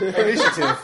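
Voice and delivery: loud, high-pitched hammed-up yelp; character's exclamation. Mocking his character's repeated word to the table, high exclamation. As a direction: blurt it high and loud, half laughing. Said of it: "So my character's like".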